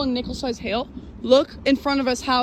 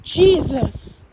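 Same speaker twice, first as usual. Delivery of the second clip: shocked shout; 5 semitones higher, 5 dB louder than usual. Real, not acted. Huge lightning strike.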